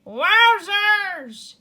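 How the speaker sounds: high, loud Gadget cry; shout. Acted. Gadget shouting as he crashes down on the agent. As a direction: whoop it out as he crashes. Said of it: "he shouted".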